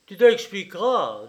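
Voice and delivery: angry demand. A narrator's reading. Frightening father stopping his son by the arm, angry demand. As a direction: demand it forcefully.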